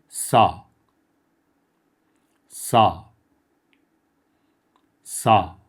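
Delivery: سا said loud and clear, drawn out for the class to echo; clear model syllable. Shown as a demonstration. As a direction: model سا loudly for repetition. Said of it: "با من تلفظش هم تکرار کنید". (تلفظ واضح و بلند سا برای تکرار)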